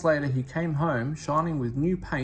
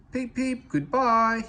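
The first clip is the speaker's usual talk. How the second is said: high cheerful whistle-call, peep peep; calling goodbye; 10 semitones higher, 5 dB louder, slower than usual. A narrator's reading. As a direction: call it out brightly like a little engine. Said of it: "he called".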